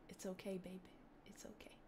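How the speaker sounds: hushed, mock-tender whisper; playful comforting whisper; soothing whisper. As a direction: whisper it very softly, as if comforting a baby. Mock soothing of an imagined listener.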